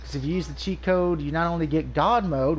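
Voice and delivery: usual speaking voice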